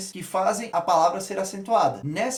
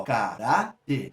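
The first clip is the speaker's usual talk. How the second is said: "caráter" slow and lower, in three separate beats; syllable by syllable; 4 semitones lower, much slower than usual. Shown as a demonstration. Teacher before asking which syllable is stressed, "caráter" slowly split. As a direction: pause between ca, rá and ter in "caráter". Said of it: "eu tenho três sílabas".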